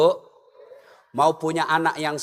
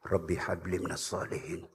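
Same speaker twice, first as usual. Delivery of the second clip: very low, soft prayer into the ear; whispered in ear; 13 semitones lower, 14 dB quieter than usual. Retold or imitated. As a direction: breathe it into the child's ear. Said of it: "Saya bisik telinganya".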